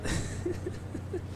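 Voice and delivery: short, high, airy laugh; high breathless giggle; amused laugh. Real, not acted. Commentator amused by the tank race, high airy laughing.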